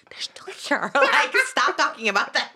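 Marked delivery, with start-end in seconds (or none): 0.1-0.9 hushed, breathy ghost-story whisper with a laugh; mock eerie whisper; mock spooky whisper. Mom talks about ghosts, mock whisper.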